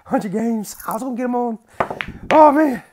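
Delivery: high, loud winner's cry; elated celebration. Real, not acted. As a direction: exult after hundreds of games. Celebrating a long-awaited win.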